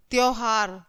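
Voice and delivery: ordinary delivery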